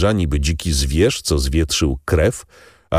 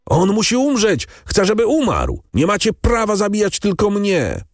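very high, frantic screamed protest; wrzask skazańca; 14 semitones higher than usual. A narrator's reading. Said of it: "Skazany wrzeszczał bez przerwy". (rozpaczliwy wrzask bardzo wysokim głosem)

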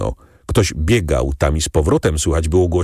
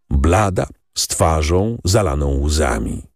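ordinary delivery

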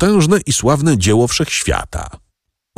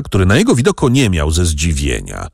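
ordinary delivery, nothing marked

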